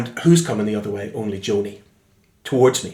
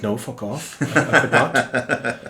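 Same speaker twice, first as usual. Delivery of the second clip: laughing refusal; slower than usual. Retold or imitated. Refusing producer's request.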